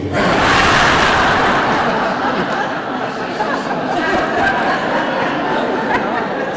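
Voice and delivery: crowd bursting into loud laughter; big roar of laughs from the room; audience laughter. Real, not acted. Audience roars at punchline.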